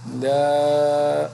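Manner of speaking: "لا" with mouth wide open, long and loud; "لا" loud and held open; open fatha. Shown as a demonstration. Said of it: "جیسے".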